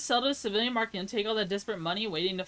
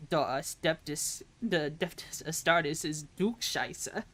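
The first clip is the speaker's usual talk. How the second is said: lower, slow put-on German accent; mock German accent; 4 semitones lower, slower than usual. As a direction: play a German boy, slowly. German boy answers the plea.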